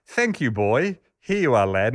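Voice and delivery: a hint of a lofty English toff tipping the waiter; exaggerated English aristocrat. Miller tipping Lord Tedder, lightly hammed English aristocrat. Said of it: "in a exaggerated English aristocrat voice".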